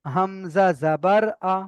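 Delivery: slow, deliberate letter-by-letter recitation; measured spelling-out drill. Shown as a demonstration. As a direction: spell out "Hamza জাবার আ" unhurriedly. Teacher demonstrates spelling-out recitation.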